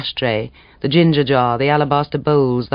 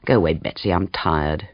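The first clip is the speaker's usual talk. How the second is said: lower, weary brush-off; a lightly voiced tired husband; tired man; 8 semitones lower, 4 dB quieter than usual. A narrator's reading. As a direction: push her away, half asleep. Morris voiced, sleepy and curt.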